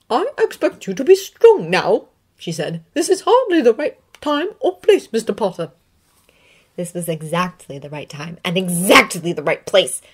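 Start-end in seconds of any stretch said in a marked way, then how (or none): 0.1-2.1 higher stern witch professor voice; a firm headmistress demanding composure; McGonagall. Acted. McGonagall admonishes Harry. 2.9-5.7 put-on high, louder, strict old professor voice; a schoolmistress putting a pupil in his place; McGonagall. McGonagall rebukes Harry.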